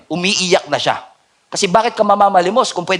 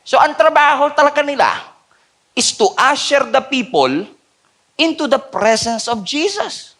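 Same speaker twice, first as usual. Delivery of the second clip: preaching at full force; 9 semitones higher than usual. Acted. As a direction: drive the point home, climbing. Preaching climbs high and loud.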